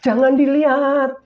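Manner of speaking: high, loud, slow alarmed cry; startled protest. Retold or imitated. Woman caught by God's eyes, high alarmed protest. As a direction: caught in the act, recoil and protest. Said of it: "Lalu dia bilang gini".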